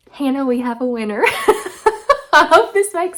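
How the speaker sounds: high, loud, laughing announcement; laughing delight. Real, not acted. Telling Hannah a five-star winner, laughing and loud. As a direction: crow a win through laughter.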